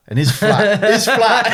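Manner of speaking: helpless giggles over the words; real laughing. Real, not acted. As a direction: try to continue but dissolve into laughter. Loses it laughing mid-story.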